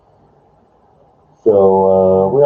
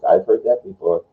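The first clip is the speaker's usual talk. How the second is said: usual speaking voice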